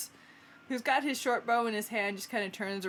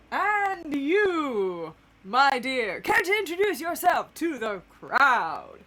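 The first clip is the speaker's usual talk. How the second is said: loud, raised theatrical invitation; showy announcer; 5 semitones higher, 6 dB louder, slower than usual. Acted. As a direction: project it grandly to the crowd. Groundhog announcer invites next contestant.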